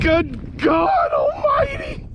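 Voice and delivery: shouting, half laughing in shock; disbelieving shouts. Real, not acted. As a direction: yell in pure disbelief at the fish. Stunned by fish's size.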